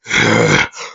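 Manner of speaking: very low grunting exhale; heavy effortful breaths. Acted. Big guy standing up again, breathing heavily and low. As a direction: heave like a brute getting back up.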